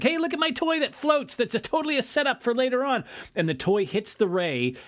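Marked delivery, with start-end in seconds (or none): 0.0-3.0 put-on high-pitched little boy showing off; Danny's excited kid voice. Kid showing dad his floating toy, high-pitched and eager. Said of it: "Danny's like".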